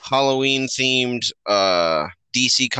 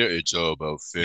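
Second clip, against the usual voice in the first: low quiet gruff movie-star voice; Vin Diesel; 6 semitones lower, 5 dB quieter, faster than usual. Retold or imitated. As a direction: mumble it low like an action hero. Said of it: "Vin Diesel".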